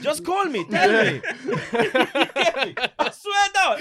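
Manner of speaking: high, loud, laughing taunt; laughing bravado. Retold or imitated. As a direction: brag it through laughter.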